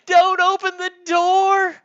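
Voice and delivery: high, loud, slowed terrified yell; crying out. Pretend. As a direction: beg mom in a full scream. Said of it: "They both cried out".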